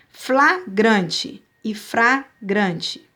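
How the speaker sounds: slow, careful flagrante then fragrante, L against R; contrast L and R. Shown as a demonstration. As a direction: spell out the L in flagrante and the R in fragrante, slowly. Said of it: "só trocou a letra L pela letra R".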